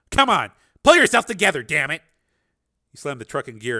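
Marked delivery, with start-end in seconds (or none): 0.1-2.0 loud, high shaken yell at himself; angry self-rebuke. A narrator's reading. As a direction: force himself to snap out of it. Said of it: "he said to himself out loud".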